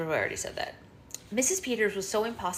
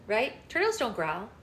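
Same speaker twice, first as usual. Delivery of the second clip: ordinary delivery, nothing marked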